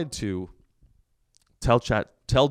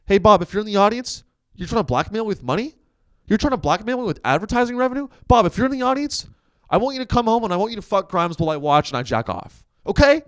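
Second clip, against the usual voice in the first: higher, rising mock Elon rant; mock honest Elon; 9 semitones higher than usual. Imitating the boss confessing to the Disney chief. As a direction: ham up an unhinged billionaire. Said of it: "be like".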